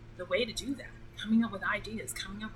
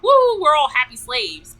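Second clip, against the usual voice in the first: loud, high, drawn-out fake cheer; mock celebration; 6 semitones higher, 14 dB louder, slower than usual. Mocking a false idea of singing slaves, hammed-up cheer. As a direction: whoop it up in mock glee. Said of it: "It wasn't about".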